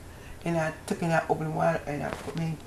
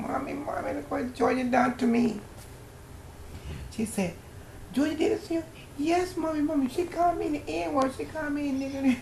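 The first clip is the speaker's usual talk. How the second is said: put-on tattling boy; 11 semitones higher than usual. Boy runs telling his mother.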